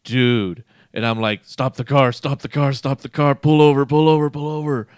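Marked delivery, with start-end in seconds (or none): 1.4-4.9 louder urgent plea, building; urgent mock yell. To the Uber driver, an urgent rising yell. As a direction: shout it urgently as nausea hits.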